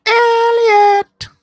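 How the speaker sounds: high, drawn-out shouted name; calling out. Real, not acted. Host calls out to co-host on the stream, shouting.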